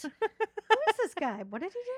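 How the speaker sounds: high-pitched, incredulous questions; mock bafflement. Locals hearing of Jesus, acting baffled. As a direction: play a thoroughly baffled local.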